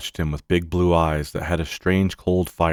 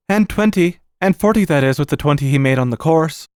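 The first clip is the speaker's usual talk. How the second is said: higher, exact, deferential servant; Bassett; 9 semitones higher than usual. Acted. Servant correcting the sum for the uncle, high and earnest. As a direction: add it up like a dutiful servant.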